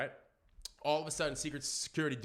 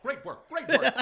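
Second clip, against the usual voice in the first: imitating Obama; 9 semitones higher, 9 dB louder, slower than usual.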